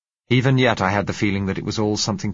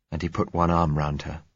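ordinary delivery

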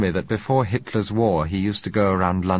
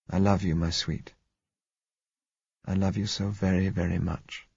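tender; 4 dB quieter than usual. A narrator's reading. Tender farewell before stepping out.

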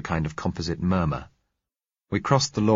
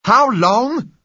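much higher, much louder furious shout; 11 dB louder than usual. Acted. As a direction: fling the question back through the bars. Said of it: "shouted the man on our side".

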